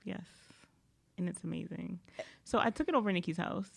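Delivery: ordinary talk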